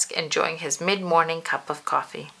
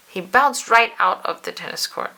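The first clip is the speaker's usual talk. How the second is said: ordinary delivery, nothing marked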